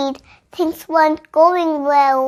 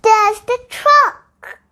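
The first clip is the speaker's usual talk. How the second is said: Jan cries out slowly, pitched higher; excited cry; 4 semitones higher, slower than usual. A narrator's reading. Fairy Jan spotting the lost truck, an excited cry. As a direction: call it out slowly, thrilled to spot it. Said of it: "cried Jan".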